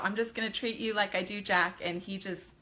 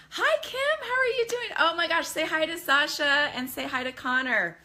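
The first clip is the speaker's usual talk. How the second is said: much higher, louder, overjoyed hello; excited greeting; 6 semitones higher, 5 dB louder than usual. Real, not acted. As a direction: gush over a familiar name. Greeting a viewer on live stream.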